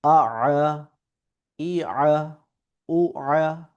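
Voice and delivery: Arabic ain on each second আ after a normal hamza vowel; ain from the throat. Shown as a demonstration. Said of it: "আইনটাকে হালাকের ভেতর থেকে". (প্রতি দ্বিতীয় আ গলার ভেতর থেকে আইন করে)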